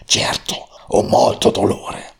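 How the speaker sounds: plain reading voice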